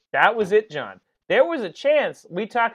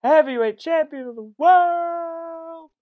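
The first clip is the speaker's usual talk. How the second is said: stretched-out announcer call; ring announcer voice; 10 semitones higher, slower than usual. Pretend.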